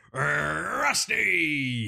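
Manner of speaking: long, drawn-out hype shout; announcer-style name call. Pretend. Announces performance of the night.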